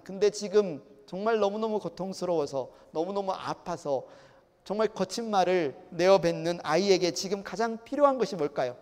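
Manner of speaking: ordinary talk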